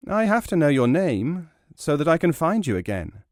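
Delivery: the naive lad pressing for a name; eager youth. Acted.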